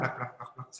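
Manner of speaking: quiet plak plak, flapping sound; mouthing flapping wings softly; soft wing flaps. Shown as a demonstration. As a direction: tap out a soft wing beat. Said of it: "saya bikin suara terbangnya seperti itu".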